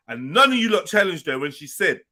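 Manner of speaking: normal talking voice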